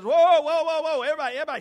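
very loud, high interrupting shout; calling a halt. Retold or imitated. Jesus walking up on arguing crowd, shouting them down.

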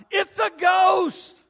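high, loud shriek of fear; a high, slow, terrified shout; terrified cry. Acted. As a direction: scream it like you've seen a ghost. Disciples cry out in terror.

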